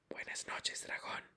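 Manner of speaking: soft breathy whisper. Acted. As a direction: whisper it so as not to startle. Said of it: "susurró el escritor". (susurro suave)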